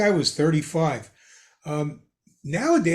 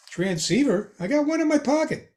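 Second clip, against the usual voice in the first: mock unimpressed kid; 10 semitones higher than usual. Modern kid dismisses radio.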